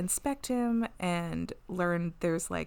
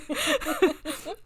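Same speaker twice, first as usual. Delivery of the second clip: high-pitched peal of laughter; amused laugh; 4 dB louder than usual. Real, not acted. Co-host picturing a kick with both feet, amused laugh. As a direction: crack up in a high giggle.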